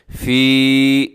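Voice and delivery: फ़ी with the long ee drawn out slowly; फ़ी, long madd on the ee; lengthened fee. Shown as a demonstration. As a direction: stretch the vowel of फ़ी. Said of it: "थोड़ा सा आपने इसको लंबा करना है".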